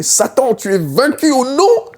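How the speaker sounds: high, loud, slow rebuke; shouting at Satan. Retold or imitated. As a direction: hurl the rebuke at the enemy. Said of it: "J'ai crié".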